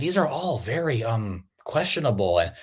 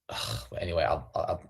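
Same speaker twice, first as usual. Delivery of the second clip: ordinary talk, nothing marked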